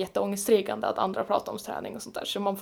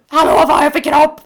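high, loud, demanding call; mock shouting; 5 semitones higher, 12 dB louder than usual. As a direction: holler it as an exaggerated plea for attention. Återger sitt eget utrop. (högt, överdrivet rop)

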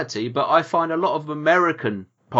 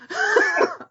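stifled giggle. Real, not acted. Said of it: "And from your laughter".

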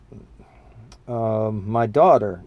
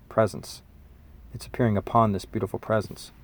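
normal talking voice

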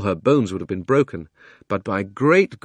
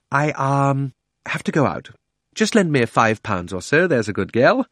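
lightly voiced uncle, slightly higher, cajoling; wheedling uncle; 5 semitones higher, 3 dB louder than usual. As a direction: lightly play a coaxing uncle asking for a loan.

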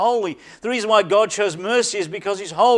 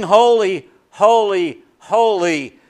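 proclaimed loud; 5 dB louder, slower than usual. Shown as a demonstration. Preacher voicing the angels' hymn to the congregation, ringing proclamation. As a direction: ring out each "holy" louder, falling at the end. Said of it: "They sing".

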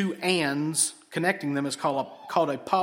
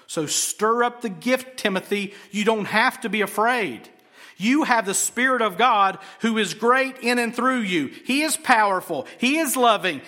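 raised, higher exhorting pulpit voice; impassioned preaching; 5 semitones higher, 5 dB louder than usual. Real, not acted. Preacher exhorts Timothy not to be afraid, in impassioned preaching. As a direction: urge them on with driving, emphatic conviction.

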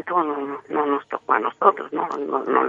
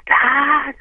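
high, loud mock scream "aah"; imitated scream; 10 semitones higher, 5 dB louder than usual. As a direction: imitate startled young people screaming. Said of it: "gritan los chavos estos". (grito agudo imitado)

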